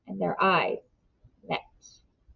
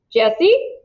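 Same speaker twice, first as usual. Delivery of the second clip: calling out; 8 semitones higher, 5 dB louder than usual. A narrator's reading. Mother calls through the woods for her son, a raised call. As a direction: call a name across the trees, a little louder and higher. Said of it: "a voice called".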